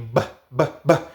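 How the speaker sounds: "ba" with lips pressed shut, voiced; lip b drill. Shown as a demonstration. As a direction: pop "ba" from closed lips. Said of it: "los labios tienen que ser así".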